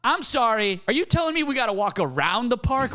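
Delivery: high-pitched put-upon protest; mock incredulous. Arguing with cops over the closed park, mock incredulous. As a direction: challenge them in mock disbelief.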